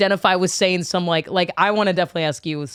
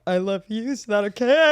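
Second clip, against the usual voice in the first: shaky nervous; 3 semitones higher than usual. Retold or imitated. Shaky nervous love confession.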